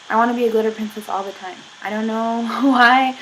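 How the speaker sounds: usual speaking voice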